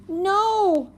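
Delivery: put-on high, loud child's shout; a boy shouting no at his parents. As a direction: yell it in protest like a little boy. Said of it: "Fudge shouted".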